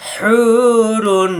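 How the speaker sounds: হুরুন with হু pressed and held long; stressed, stretched vowel. Shown as a demonstration. Teacher pressing the হু of হুরুন for students. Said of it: "হুকে চাপ দিয়ে এক আলিফ টানতে হবে".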